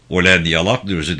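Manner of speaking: ordinary delivery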